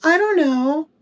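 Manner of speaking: put-on very high little-kid voice; put-on high-pitched child; young daughter. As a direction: imitate a carefree child shrugging it off. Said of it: "She's like".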